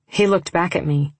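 plain reading voice

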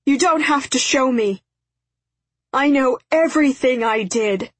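high, loud tearful shout; shouting through tears. A narrator's reading. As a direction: cry it out, sobbing and shouting. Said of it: "he shouted through his tears".

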